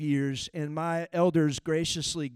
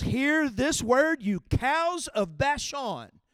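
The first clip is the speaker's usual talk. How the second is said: slow, drawn-out, higher proclamation as God; voicing God; 7 semitones higher, much slower than usual. Pastor voicing God calling out Israel's women, slow and high. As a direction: stretch each word out like God's rebuke. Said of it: "He goes".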